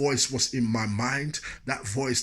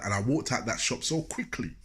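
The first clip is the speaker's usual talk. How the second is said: ordinary talk, nothing marked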